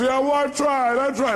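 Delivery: high mock whine of protest; put-on high voice, defensive; imitated woman caller. Caller protests to the coach, imitated in a high whine. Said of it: "She goes".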